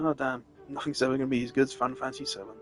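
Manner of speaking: normal talking voice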